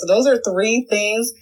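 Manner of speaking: normal talking voice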